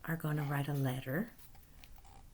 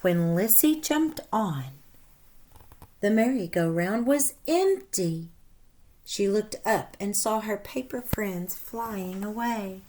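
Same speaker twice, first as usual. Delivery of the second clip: lively read-aloud storytelling voice; animated storybook narration for children; story narration; 4 semitones higher, 7 dB louder than usual. A narrator's reading. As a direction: read it like a picture book to kids. Reads story aloud to class.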